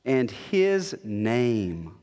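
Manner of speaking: very slow, low, weighting "his name"; stress on his name. Shown as a demonstration. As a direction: draw out "his name" solemnly. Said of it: "this emphasis on his name".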